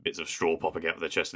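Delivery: ordinary talk, nothing marked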